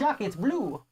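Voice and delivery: light mock French accent on "Shock, it's blue"; a playful hint of a French accent, "Shock" said like "chic". Husband teasing wife over the game, mock French accent. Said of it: "I won't do my French accent".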